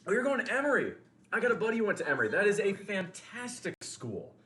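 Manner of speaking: high, loud, thrilled reaction; light up at the news; excited surprise. Real, not acted. Streamer reacting to a viewer going to Emory, excited.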